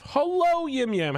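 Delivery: high, bright sing-song greeting; sing-song hello. Real, not acted. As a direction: call out a cheery hello. Greeting a player who joined.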